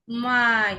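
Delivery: "মাই" with a drawn-out "আই". Shown as a demonstration. Teacher giving an ai example, stretched "আই". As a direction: hold the glide in "মাই". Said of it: "আই এর মতো".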